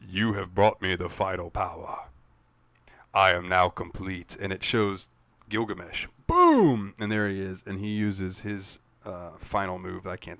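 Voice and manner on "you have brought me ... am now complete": ominous voice. Retold or imitated. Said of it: "this voice comes up and says".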